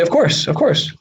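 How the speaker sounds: high-pitched, repeated ready answer; quoted eager reply. Acted. Learners answering a question, eager. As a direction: answer readily with the pitch jumping up. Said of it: "And people say".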